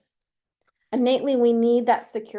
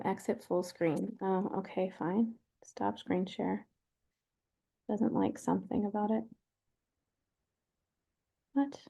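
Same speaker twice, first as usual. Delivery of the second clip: talking to oneself; 3 semitones lower, 11 dB quieter than usual. Real, not acted. Presenter fixing screen share on a webinar, talking to oneself. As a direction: say it low to yourself while working through steps.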